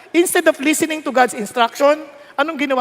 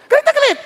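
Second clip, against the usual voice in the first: "Galit na galit" pitched very high and loud; loud, high exclamation on "Galit na galit"; emphatic repeat; 6 dB louder, much faster than usual. Real, not acted. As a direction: hit "Galit na galit" hard and high. Stressing how angry Moses was.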